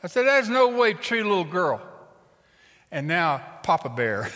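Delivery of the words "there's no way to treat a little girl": high, forceful reproach; loud scolding. Acted. Preacher confronts mother over her girl, scolding. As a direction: tell her off, raised and loud.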